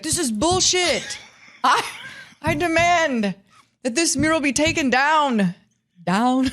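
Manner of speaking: high, slow mock Slovenian accent, laughing through it; teasing Slovenian accent impression. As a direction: ham up a Slovenian star's demand. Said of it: "in a Slovenian accent".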